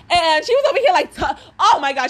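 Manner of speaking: ordinary talk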